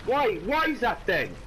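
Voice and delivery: high, drawn-out indignation; outraged complaint. Real, not acted. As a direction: cry foul at the game, aggrieved. Raging at the game.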